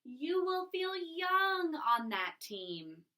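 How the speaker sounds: slow, bright and cheerful; encouraging. Shown as a demonstration. Missus T Berry cheering up Mister Putter, encouraging. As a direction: promise it warmly. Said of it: "how I tried to bring Mister Putter and Missus T Berry to life".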